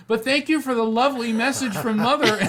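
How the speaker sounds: high, loud sing-song thanks with a laugh; prim churchwoman thanks. Retold or imitated. Churchwoman thanking the medium as he leaves, high loud laughing gush.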